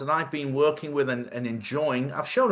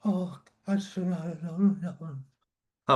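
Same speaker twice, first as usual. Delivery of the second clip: quoted awed group; 4 semitones higher, much slower than usual. Retold or imitated. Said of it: "They were all like".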